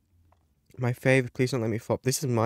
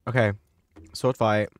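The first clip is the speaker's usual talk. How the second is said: ordinary delivery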